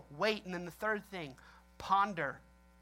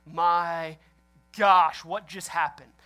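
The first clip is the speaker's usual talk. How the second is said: stunned and louder, slowing on what just happened; like you just heard a gun go off by accident; shocked gasp; 8 dB louder, much slower than usual. Retold or imitated. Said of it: "you just kinda stand back like".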